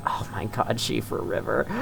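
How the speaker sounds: breathless, very soft awe; near-whispered wonder; awed hush. Real, not acted. Awed by the scenery.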